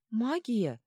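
high, mildly tearful question. A narrator's reading. Girl offering her magic, mildly tearful. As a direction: ask hopefully through a touch of tears. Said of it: "всхлипывая, спросила Винью".

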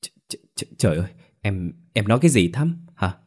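stunned stutter on the first words; stammering. A narrator's reading. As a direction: stumble over your words in disbelief. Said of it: "Tú lắp bắp".

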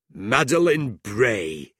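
drawn-out triumphant discovery; relishing the find, slowly; excited. A narrator's reading. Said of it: "he said excitedly".

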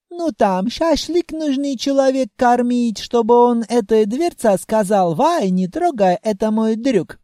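put-on sly Caucasian-accented proposal. Djinn scheming in accent.

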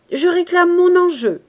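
high, loud outcry; loud demand. A narrator's reading. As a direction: demand it over the tumult. Gentleman demanding his stake back. (réclamation criée, voix haut perchée)

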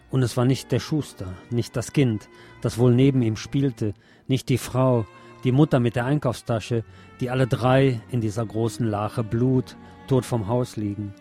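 usual speaking voice

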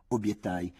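ordinary delivery, nothing marked

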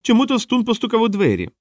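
high-pitched clown telling a riddle; delighted squeaky riddle; gleeful clown. A narrator's reading. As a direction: set up the joke in a high, eager voice. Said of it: "радів він".

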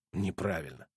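low muttered word under the breath. A narrator's reading. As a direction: mutter it to yourself. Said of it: "пробормотал я".

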